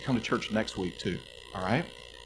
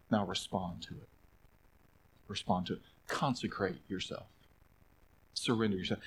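quiet appeal; 5 semitones lower, 10 dB quieter than usual. Real, not acted. Softly appealing to listeners.

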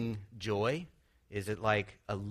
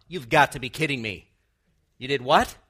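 put-on loud, incredulous scolding of a child; exasperated outburst; 3 semitones higher, 11 dB louder than usual. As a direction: blow up like a fed-up father. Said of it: "God never has this kind of a response".

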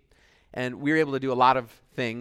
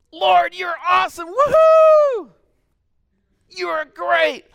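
high, loud whooping praise; cheering praise; 12 dB louder, slower than usual. Pretend. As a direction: whoop for God like a touchdown. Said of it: "screaming my fool head off in worship".

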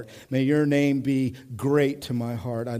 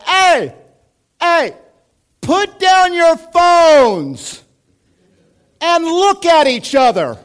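much higher, louder shout, slow and drawn out; imagined shout; 13 dB louder, slower than usual. Pretend. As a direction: yell it across the whole restaurant. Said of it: "stand up in the middle of the restaurant and go".

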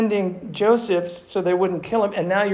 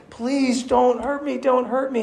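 begging; 7 semitones higher than usual. Retold or imitated. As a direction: imitate a brother pleading for mercy. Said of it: "He kept begging".

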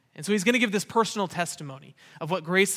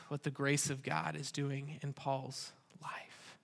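whisper; 3 semitones lower, 11 dB quieter, slower than usual. Real, not acted. Hushed summary after reading.

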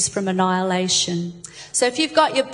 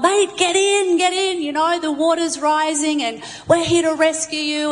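raised, higher call to the man on the roof; 6 semitones higher than usual. Acted. Rescuers in a tinny calling to the man on the roof, urgent raised call. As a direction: shout up urgently from the boat.

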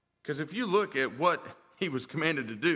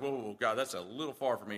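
lower, softer mock recoil from God's request; Ezekiel balking; 7 semitones lower, 6 dB quieter, faster than usual. Preacher voicing Ezekiel refusing God's command, lowered quiet balk. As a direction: Ezekiel pulling back, dropped low and quiet. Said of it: "Ezekiel's like".

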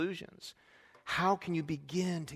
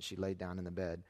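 ordinary talk, nothing marked

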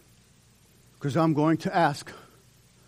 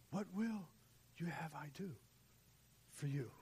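much softer, whispered question; Jesus softly asking what they need; gentle whisper; 18 dB quieter than usual. Retold or imitated. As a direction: breathe it tenderly as Jesus. Voicing Jesus, very soft.

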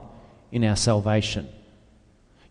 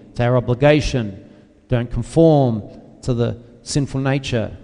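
normal talking voice

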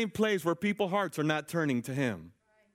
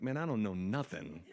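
chuckling through the words; laughing denial; 3 semitones lower, 8 dB quieter than usual. Retold or imitated. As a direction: laugh it off, wanting no part. Laughing off involvement.

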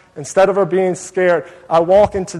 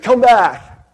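calling out; 6 semitones higher, 6 dB louder than usual. Retold or imitated. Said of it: "the best she could".

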